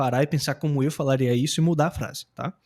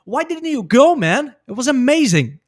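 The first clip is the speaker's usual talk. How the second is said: "Why didn't you go" high and loud, rising on "go"; same intonation transferred; 7 semitones higher, 5 dB louder, slower than usual. Shown as a demonstration. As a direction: punch "go" and "amazing" high, "amazing" falling. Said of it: "Vou aplicar a mesma entonação".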